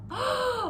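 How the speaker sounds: high, sharp mock gasp of alarm; dramatic gasp. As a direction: gasp as if the bear just woke. Friends frozen, bear wakes.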